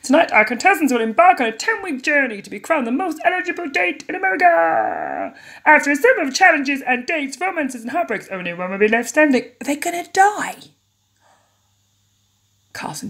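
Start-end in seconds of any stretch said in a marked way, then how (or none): 0.0-10.6 high, dramatic game-show host; a hammed-up TV host announcement; Carson's host voice. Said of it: "I can't do that voice".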